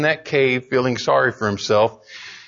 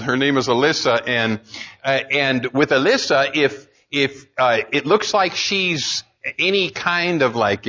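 usual speaking voice

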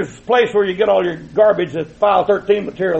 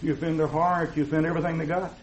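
softer, lower mocking of critics; a hint of prim, soft critics; mock soft advice; 6 semitones lower, 11 dB quieter than usual. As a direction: mimic meek critics gently scolding. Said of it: "People always tell me".

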